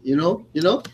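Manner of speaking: put-on accent, high and loud, mocking her catchphrases; Mackenzie Dern. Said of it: "the attention she gets for the accent thing".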